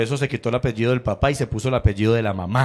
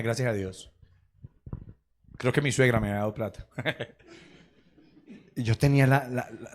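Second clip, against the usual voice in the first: ordinary talk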